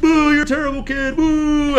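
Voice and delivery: high mock jeering boos; mock booing. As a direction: jeer at the kid playfully.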